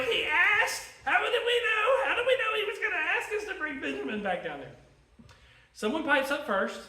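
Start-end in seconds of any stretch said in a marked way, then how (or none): 0.0-4.7 loud, high-pitched mock indignation; defensive protest. Brothers defending themselves to their dad, high defensive protest. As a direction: protest your innocence in a comically raised voice.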